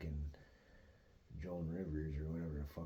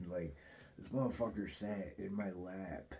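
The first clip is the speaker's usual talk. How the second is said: ordinary talk, nothing marked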